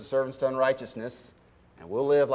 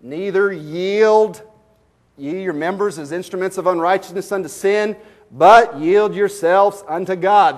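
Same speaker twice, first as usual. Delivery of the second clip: solemn scripture reading; 3 semitones higher, 6 dB louder than usual. Acted. Slow emphatic scripture reading.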